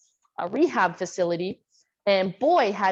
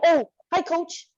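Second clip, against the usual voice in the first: higher-pitched mock greeting; imitating surprised boys; 7 semitones higher than usual. Boys run into their coach, imitated in higher voice. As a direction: play boys caught off guard by their coach. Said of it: "they see me and they go".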